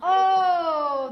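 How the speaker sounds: long, loud, high "Oh"; exclaimed wonder. A narrator's reading. Teacher opening a picture book for kids, drawn-out wonder. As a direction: stretch the Oh big and loud.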